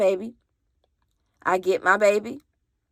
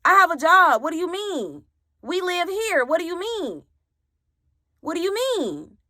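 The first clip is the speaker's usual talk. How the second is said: higher, indignant snap-back; indignant retort; 7 semitones higher than usual. Retold or imitated. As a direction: fire it back in disbelief. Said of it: "I said".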